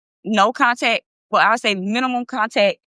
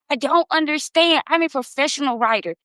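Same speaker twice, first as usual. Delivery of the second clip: ordinary delivery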